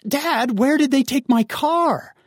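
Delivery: high, slow, pleading demand; exasperated teen. Acted. Teen asks dad where the car went, exasperated. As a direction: drag out the outrage at Dad.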